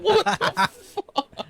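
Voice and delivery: high, drawn-out squeal through laughter; laughing disbelief. Retold or imitated. Dad watching daughter carry on, laughing mock disbelief. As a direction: stretch it out high, cracking up.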